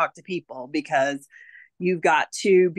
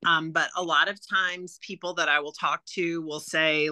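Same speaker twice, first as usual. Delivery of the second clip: normal talking voice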